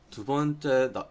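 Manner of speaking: ordinary delivery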